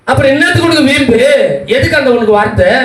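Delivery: high-pitched, exasperated questioning; scolding challenge. Real, not acted. Preacher scolds people's stubborn words. (உயர்ந்த குரலில் கண்டிக்கும் கேள்வி)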